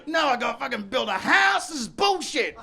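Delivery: high, loud dopey whine, laughing through it; dumb whiny Tory. Retold or imitated. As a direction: play a thick toff throwing a tantrum. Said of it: "they must be like".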